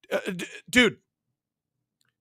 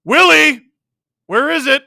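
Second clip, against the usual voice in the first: high, loud call at the player; urgent shout; 12 semitones higher, 14 dB louder than usual. Real, not acted. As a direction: shout out in alarm mid-play. Calls out during live play.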